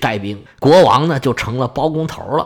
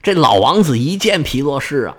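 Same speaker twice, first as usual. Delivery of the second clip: ordinary delivery, nothing marked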